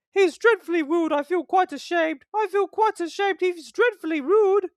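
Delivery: put-on shocked old ladies twittering; the coaches gossiping about Thomas, high twitter. A narrator's reading. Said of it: "they twittered to each other".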